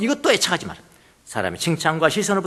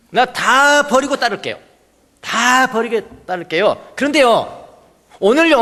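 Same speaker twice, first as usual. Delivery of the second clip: higher, slow, earnest begging to Jesus; pleading would-be disciple; 6 semitones higher, 5 dB louder than usual. Acted. Disciple pleads with Jesus before following, higher and slower. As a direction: beg Jesus to let him bury his father first. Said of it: "제자가 되겠다고 예수님을 찾아왔습니다".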